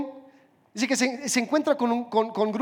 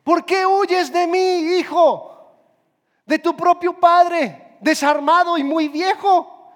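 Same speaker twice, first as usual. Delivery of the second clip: higher and louder, slow, calling after him; pleading shout; 4 semitones higher, 5 dB louder than usual. Retold or imitated. Old Juan runs after the young thief, pleading shout. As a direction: beg the runaway son to stop, at full voice. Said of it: "[name] cita lo que Juan grita".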